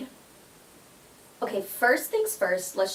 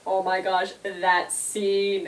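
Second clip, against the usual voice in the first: ordinary talk, nothing marked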